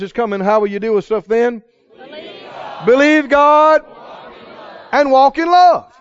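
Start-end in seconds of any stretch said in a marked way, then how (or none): 2.9-5.9 high, ringing call to the congregation; rousing preaching shout. Real, not acted. Preacher answers how to deal with stuff, shouting. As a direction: shout the refrain with full conviction.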